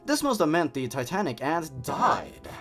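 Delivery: ordinary talk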